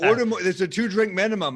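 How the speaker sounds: high, loud imitation of the owner hustling drinks; mock the owner's sales pitch; a hammed-up pushy club owner. Club owner pushing drinks on stage.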